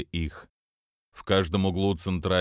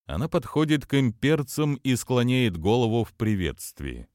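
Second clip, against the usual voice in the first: usual speaking voice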